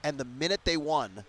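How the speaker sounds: ordinary delivery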